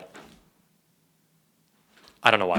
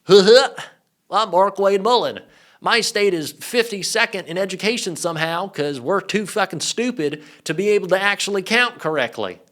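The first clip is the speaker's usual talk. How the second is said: cartoon character with a terrible put-on accent; high, hammed-up dopey cartoon voice; badly faked accent, raised and goofy, mocking a senator; Markwayne Mullin; 5 semitones higher, 3 dB louder than usual. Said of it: "That was a terrible accent".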